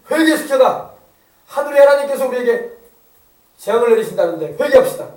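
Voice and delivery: loud, forceful decree in punched phrases; royal proclamation. Acted. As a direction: proclaim urgent orders like a king to his people. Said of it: "금식을 선포했어".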